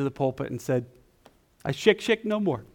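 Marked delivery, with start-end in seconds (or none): 1.6-2.6 put-on high, triumphant Italian accent; lightly voiced Italian preacher, higher. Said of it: "in his Italian accent".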